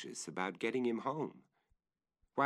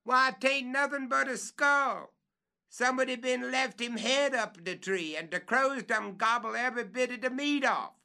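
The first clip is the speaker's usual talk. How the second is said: much higher, louder report; calling down; 5 dB louder than usual. Acted. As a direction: tell what he sees from up high.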